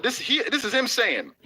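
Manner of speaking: ordinary delivery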